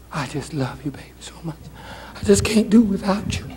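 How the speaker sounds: mock tender whisper; whispered sweet talk. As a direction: whisper it close like an adoring man. Man murmuring sweet talk.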